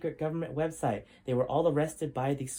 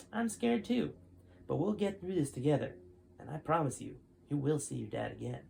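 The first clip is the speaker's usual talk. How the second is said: steady storytelling read-aloud voice; story narration; 3 semitones lower than usual. A narrator's reading. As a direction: tell the chapter like a bedtime story. Reads chapter twelve aloud.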